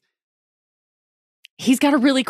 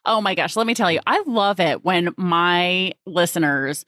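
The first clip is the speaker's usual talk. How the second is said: ordinary talk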